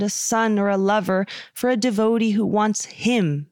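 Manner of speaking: ordinary talk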